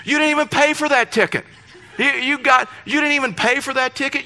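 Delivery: high-pitched jealous protest; mock indignation. Pastor telling off Gary over free ticket, indignant. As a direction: rail at a lucky friend, voice pushed way up.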